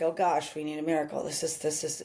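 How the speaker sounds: low, soft, defeated sigh of despair; mock-hopeless fan giving up; doom and gloom. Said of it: "I don't wanna show up like".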